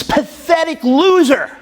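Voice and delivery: high, drawn-out mock fury; furious self-insult. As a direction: berate yourself, pitching up and stretching the words. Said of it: "And I'm furious".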